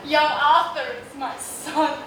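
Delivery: ordinary delivery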